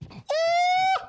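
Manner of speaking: sudden high shouted oh, far from his own voice; strangled cartoon scream; imitated scream. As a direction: mimic the character's pained yelp. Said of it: "I don't know what that scream was".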